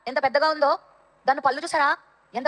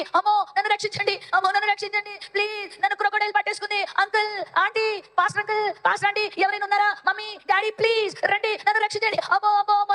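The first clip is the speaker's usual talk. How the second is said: high, pleading storyteller's scream; acted cry for help; 8 semitones higher than usual. Pyari begs adults for rescue from crocodile, acted screaming. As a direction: call out high and urgently to anyone near. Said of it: "అని అరుస్తుంది".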